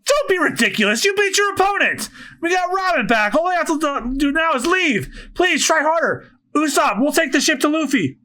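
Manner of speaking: high-pitched, insistent pleading; urgent encouragement. Acted. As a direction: push an exhausted friend to keep going. Urging exhausted friend to move.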